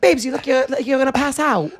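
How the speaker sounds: high, exaggerated English accent; put-on English accent, pitched well up. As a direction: imitate a worried Englishwoman. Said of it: "accents like that are offensive".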